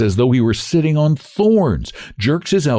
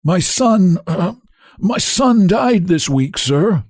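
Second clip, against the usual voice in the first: husky; 11 semitones higher, slower than usual. A narrator's reading. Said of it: "brings out huskily".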